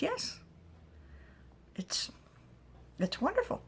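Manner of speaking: squeaky delighted critter; high creature voice. Acted.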